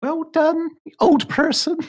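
high sing-song teasing; patronising pat on the head; mock praise. Real, not acted. Mocking partner over calendar.

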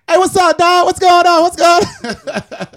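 loud, high, hyped greeting; high octane. Shown as a demonstration. Florida guy demoing how his people greet each other, loud. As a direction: yell it like a pumped-up South Florida homie. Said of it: "we talk in the high, the high octane".

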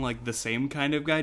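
ordinary delivery

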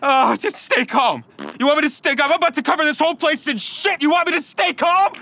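high frantic yelling; hysterical panic. Acted. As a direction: shriek in desperate terror. Panics mid-bathroom attack.

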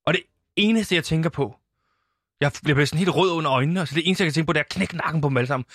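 ordinary talk, nothing marked

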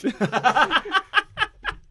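high, loud laugh; burst of laughter. Real, not acted. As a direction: break into laughter at yourself. Laughing at own quote.

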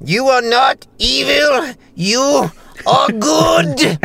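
high, very slow, stretched-out impression; Gene Wilder. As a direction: draw every word out high, with big dramatic pauses. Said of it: "I do Gene Wilder".

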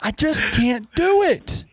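high, slow, stretched-out whine; slow comic wail of defeat; drawn-out exasperated whine. Real, not acted. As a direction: moan it like you've truly given up. Exasperated at the broadcast.

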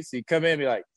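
usual speaking voice

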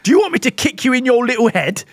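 hammed-up threat in a failed New York accent; Jason Statham. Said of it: "This is my New York accent".

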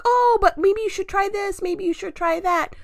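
a lightly voiced eager advisor; mimicking advice givers. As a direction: mimic pushy well-meaning people. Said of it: "people are like".

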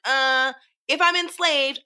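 loud, slow, pointed comeback; emphatic retort. Real, not acted. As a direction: lean hard into the retort. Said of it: "I'm like".

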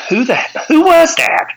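high mock shock at the driver; stunned. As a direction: squeak out the disbelief. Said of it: "And I'm like".

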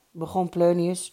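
ordinary delivery, nothing marked

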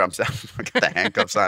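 ordinary talk, nothing marked

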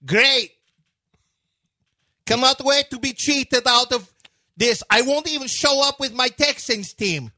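put-on high, singsong Russian-accented grievance; aggrieved rant in a hammed-up Slavic accent; Darko. Coach accusing officials of cheating, high mock Russian accent. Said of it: "Your Darko impression is".

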